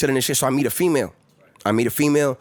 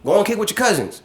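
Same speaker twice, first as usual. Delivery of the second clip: imitating dad; 9 semitones higher than usual. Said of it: "My pops was like".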